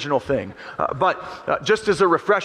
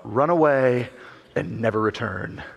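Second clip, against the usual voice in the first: deep, soft sinister command; low menacing Scar; 7 semitones lower, 4 dB quieter than usual. Retold or imitated. Scar telling young Simba to flee, low and sinister. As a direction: send him off with quiet menace. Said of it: "He says".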